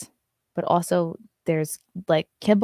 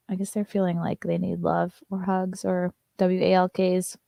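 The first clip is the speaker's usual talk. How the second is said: ordinary talk, nothing marked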